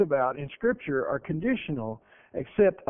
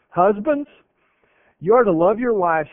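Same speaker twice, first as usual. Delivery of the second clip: preaching scripture; 4 semitones higher, 6 dB louder than usual. Acted.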